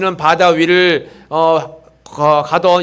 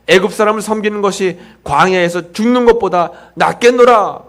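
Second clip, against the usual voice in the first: higher, louder, reading the rebuke with force; scripture reading; 4 semitones higher, 5 dB louder than usual. Acted. Pastor reads the Israelites' complaint to Moses, forceful. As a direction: proclaim the scripture with rising force.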